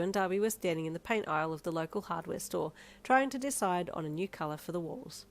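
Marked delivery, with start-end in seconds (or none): none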